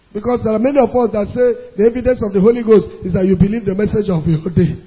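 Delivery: normal talking voice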